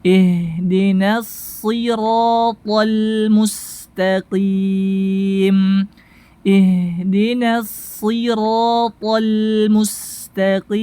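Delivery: slow tajwid recitation with long held vowels; measured verse, stretch the final EEM; Quran recitation. Shown as a demonstration. Teacher modelling the whole verse, slow recitation. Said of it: "Kita masuk kepada praktek".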